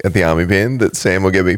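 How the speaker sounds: ordinary talk, nothing marked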